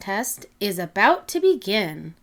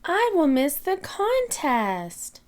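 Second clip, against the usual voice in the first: sad; 6 semitones higher than usual. Acted. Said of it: "Peppa says sadly".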